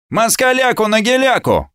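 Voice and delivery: high-pitched chanted cry; tentative shout, pitched far up; shouted slogan. A narrator's reading. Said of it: "крикнул кто-то".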